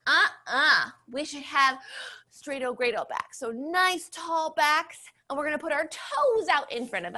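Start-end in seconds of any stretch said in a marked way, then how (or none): none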